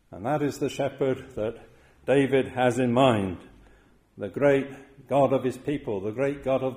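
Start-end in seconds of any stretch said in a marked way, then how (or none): none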